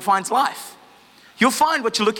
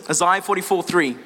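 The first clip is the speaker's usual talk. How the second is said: ordinary delivery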